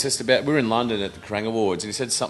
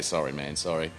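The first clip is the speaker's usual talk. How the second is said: low, soft acted apology; meek apology; 7 semitones lower, 6 dB quieter than usual. Storyteller replaying an apology to the entourage, meek and low.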